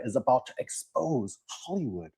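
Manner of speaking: sinking into a hushed, secretive imitation; mock whispering. Host mocking the actor exposing Hollywood, mock whispering. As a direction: drop the volume as if leaking a secret. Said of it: "So he's whispering".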